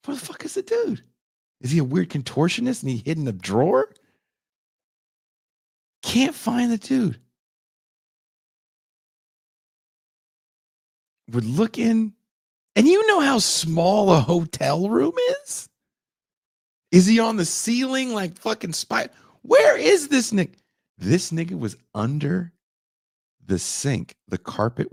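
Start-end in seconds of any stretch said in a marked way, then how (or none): 0.1-1.0 high, squeaky disbelief; baffled. Real, not acted. Searching the room for the vanished man, baffled. 6.0-20.6 exasperated. Retold or imitated. Hunting the hotel room for the hidden man, exasperated. Frantic search retold.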